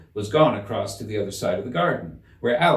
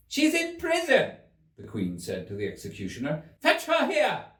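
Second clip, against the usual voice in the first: very high, clipped royal order; imperious Queen; 13 semitones higher than usual. A narrator's reading. Queen orders the executioner to fetch the Duchess, very high. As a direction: command the executioner at once.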